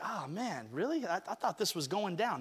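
deflated, imitating your own disappointment; disappointed sigh. Voicing disappointed self.

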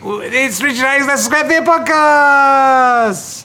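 high, drawn-out shouted title; show announcer. Pretend. Announcer launching the podcast, big title shout. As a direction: build to a long held last word.

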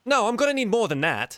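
high, loud halfling haggler; haggling halfling. Acted. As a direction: drive a hard bargain like a halfling. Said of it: "sounds like a halfling barter".

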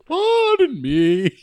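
high, slow goofy character voice; Diane voice. Acted. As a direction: drag it out high and silly. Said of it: "Diane's coming".

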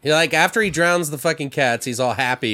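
usual speaking voice

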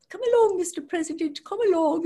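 high, quick posh British voice; imitating the Queen. Queen shepherding the president, in an imitated posh British voice. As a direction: coax him along like a queen.

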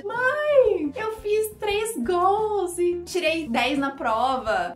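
put-on high, stretched-out child announcing good news; excited child. Child coming home to tell mom, excited. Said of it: "Imagina uma criança chega em casa".

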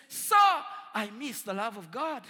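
loud, high plea; a shouted confession of longing; desperate cry. Retold or imitated. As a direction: call out to him urgently. John runs to the old man.

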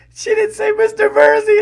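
high-pitched, loud mock howl of a friend; howling imitation. Friend tattling to Bill through hysterics, high howling mimicry. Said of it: "He's howling to himself".

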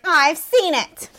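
dismissive Daphne. Retold or imitated. Daphne turning down the tour, dismissive. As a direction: stretch every word, as if above it all. Daphne's bored reply, acted out.

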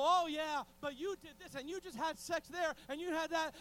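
loud, high mock taunting; devil's accusation. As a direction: needle them as the accusing devil. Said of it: "The devil".

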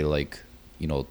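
ordinary talk

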